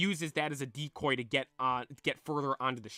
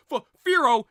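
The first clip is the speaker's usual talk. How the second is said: sudden high frightened yelp; scared yelp; 10 semitones higher, 13 dB louder than usual. Acted. Bill, scared of the Fearow, frightened yelp. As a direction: shriek the name in fright.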